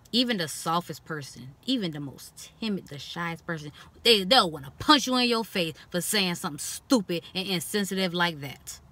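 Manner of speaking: ordinary talk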